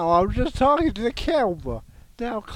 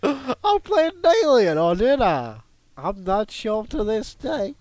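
high, odd celebrity voice; a fading impression; strained impression; 6 semitones higher, 4 dB louder than usual. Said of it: "running out of impression".

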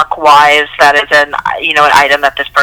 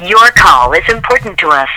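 recorded phone message. Real, not acted. Automated hold line during prank call.

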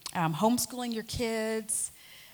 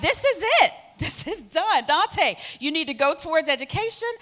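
much higher and louder, eager and certain; excited decision; 8 semitones higher, 7 dB louder than usual. Retold or imitated. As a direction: announce the plan with a delighted laugh. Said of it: "So I was like".

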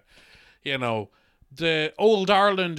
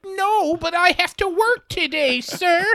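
very high, small pleading voice; high-pitched polite protest; meek squeak; 13 semitones higher than usual. Acted. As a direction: squeak it out like a timid shop worker. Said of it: "And you're like".

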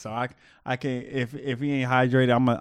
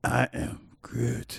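sinister Groot; 3 dB quieter, much slower than usual. Pretend. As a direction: say it like a lurking monster. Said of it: "So you doing it".

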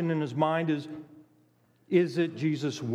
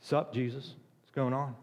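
mock casual greeting; 4 semitones lower, 4 dB quieter than usual. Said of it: "Does beg sound like".

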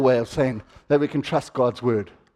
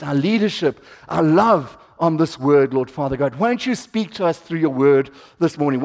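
prayer; 4 semitones lower than usual. Acted. Opening prayer.

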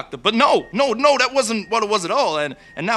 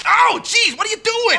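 startled ow; 12 semitones higher than usual. Acted. Zapped by the doctor.

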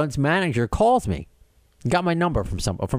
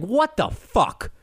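exasperated; 8 semitones higher, much slower than usual. Retold or imitated. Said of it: "I'm just like".

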